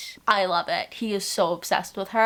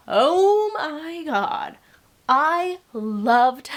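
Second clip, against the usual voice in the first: gushing; 7 semitones higher, 4 dB louder, much slower than usual. Real, not acted. Gushing over favorite book.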